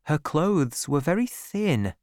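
ordinary delivery, nothing marked

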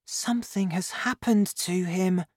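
put-on high young boy's voice, worried; high-pitched, childlike worry; worried child's thought. A narrator's reading. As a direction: think aloud as an anxious child. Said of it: "I thought".

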